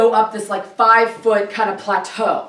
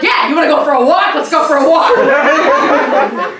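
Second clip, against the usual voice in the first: loud, higher mock enthusiasm; sarcastic outburst; 4 semitones higher, 5 dB louder than usual. Soaked woman answering her boyfriend's invitation, loudly sarcastic.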